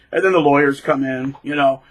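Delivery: usual speaking voice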